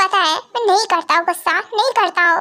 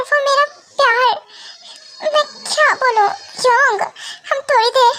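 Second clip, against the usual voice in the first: tearful pleading; 5 semitones higher than usual. Acted. Girl begs friend to let her stay with her hurt love, tearful and high. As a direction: beg to stay a little longer, near tears.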